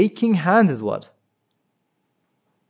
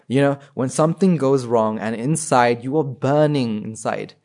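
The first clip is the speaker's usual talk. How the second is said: ordinary delivery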